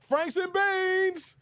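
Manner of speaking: high, long-drawn holler of a movie catchphrase; mock yell. Streamer quoting a movie catchphrase, high mock yell. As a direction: stretch it out in a high, silly yell. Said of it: "I'm just gonna yell".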